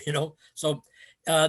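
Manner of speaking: usual speaking voice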